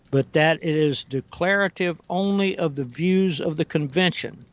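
slow, deliberate quote reading. Real, not acted. Host reading a founder's quote so listeners understand, very slowly. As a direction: read it out slowly, pausing between phrases. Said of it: "gonna read it very slowly".

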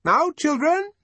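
put-on high, loud call to the children. A narrator's reading. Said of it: "she called".

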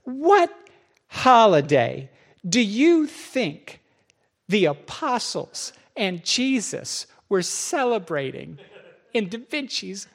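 slow, pointed quizzing; patronizing. Retold or imitated. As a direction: lay it out like to a child. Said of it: "he says".

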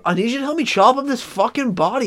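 high, squeaky bossing bro voice; high mock bro yelling at his girlfriend; bro killer voice. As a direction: boss her around like a frantic bro.

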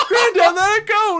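very high, loud mock plea; pleading grandchildren. Children begging angry granddad to drop it, high pleading.

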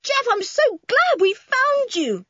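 very high, excited shouting; overjoyed yell. A narrator's reading. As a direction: yell with relief at finding them. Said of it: "yelled Toby".

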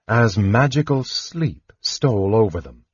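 usual speaking voice

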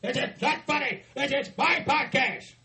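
a wrestling promo tirade at full volume; hammed-up furious rant. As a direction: blow up in fake fury at the boss. Rants at Vince McMahon.

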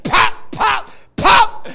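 loud, high slap noises; mock pops. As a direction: pop out each hit. Retelling more slaps.

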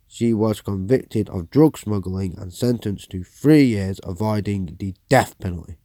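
loud, formal newsreader delivery; news read. Acted. Presenter reading a news report to viewers, formal newsreader voice. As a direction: read the headline story like an anchor.

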